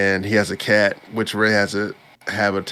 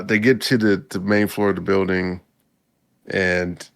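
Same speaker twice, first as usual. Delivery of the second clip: ordinary talk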